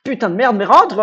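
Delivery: fed-up outburst at the game; frustrated cursing. Real, not acted. Pokémon won't enter ball.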